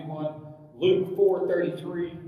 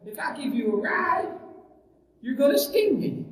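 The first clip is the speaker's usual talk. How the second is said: higher-pitched voice for a doubting duck; lightly acted fable duck, pitched up; wary duck; 8 semitones higher than usual. Duck answers the scorpion's request for a ride, wary and high. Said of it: "And the duck's going".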